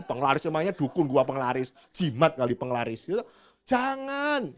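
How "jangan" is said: mock refusal.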